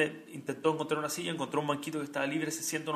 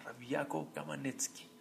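hushed reverent name; 10 dB quieter than usual. Acted. Nombre reverente.

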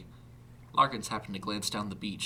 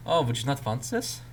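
put-on surprised German-accented question, higher and louder; 5 semitones higher, 7 dB louder than usual. A narrator's reading. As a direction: ask it dumbfounded in a German accent. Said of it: "a German accent now readily apparent".

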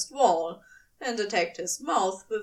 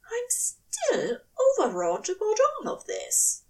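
higher, strained angel voice; overwrought angel; 11 semitones higher than usual. A narrator's reading. Angel confessing he is overwrought, higher voice. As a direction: let the strain show.

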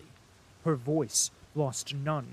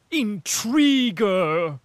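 slow, very loud drawn-out insult; vicious hiss; 7 semitones higher, 11 dB louder, much slower than usual. A narrator's reading. Princess flings the insult at Anna Mikhailovna, slow and loud. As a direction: spit the word out slowly and hard. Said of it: "she hissed viciously".

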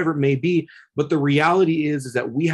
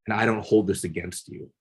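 gentle reassurance; 4 semitones lower, 5 dB quieter than usual. Retold or imitated. To the sobbing inmate through the door, soft and tender. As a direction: quietly tell a weeping young man he is loved. Speaking to his attacker, gently.

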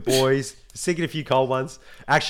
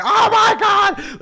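very high, loud, slow "Oh my God"; overjoyed shock at reading the praise; stunned exclamation; 11 dB louder, much slower than usual. Retold or imitated. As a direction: nearly squeal it in disbelief. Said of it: "I'm just swearing, going".

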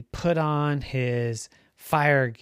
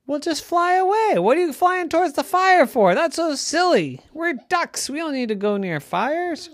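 high, loud scolding; exasperated parents; 14 semitones higher, 7 dB louder than usual. A narrator's reading. Parents scold Ducky for flying toward fire, exasperated. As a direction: tell him off as if it's absurd.

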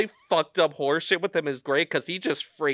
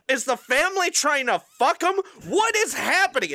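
high, alarmed questions; panicked buddy; 10 semitones higher than usual. Retold or imitated. Friend imitating buddy freaking out, high and alarmed.

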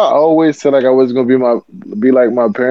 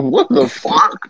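stunned; 11 semitones higher, 3 dB quieter, much slower than usual. Retold or imitated. Reacting to the kid's line.

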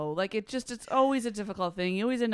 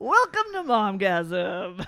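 announcer; 5 dB louder, much slower than usual. Acted. Podcast intro announcement.

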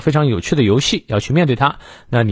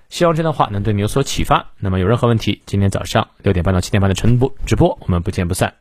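ordinary delivery

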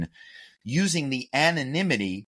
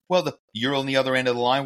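ordinary talk

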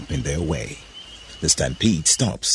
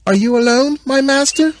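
very high, loud call; calling from hiding; 8 dB louder than usual. A narrator's reading. Hidden Eve calling to Adam, high loud call. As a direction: call out high to someone far off.

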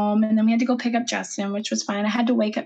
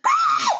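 high-pitched retold shriek of exasperation; frustrated scream. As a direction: let out a high mock scream. Acting out her exasperated outburst.

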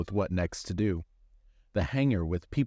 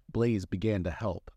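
plain reading voice

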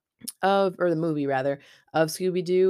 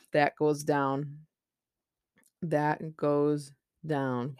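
slow dramatic; much slower than usual. Acted. Slowed dramatic repetition.

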